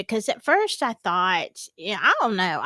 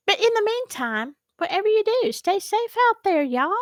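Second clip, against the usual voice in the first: much higher, bubbly farewell to viewers; bright sign-off; 10 semitones higher than usual. Real, not acted. Host waving off her viewers with a high, bright farewell. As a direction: lift the voice high for the goodbye.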